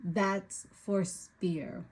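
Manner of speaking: normal talking voice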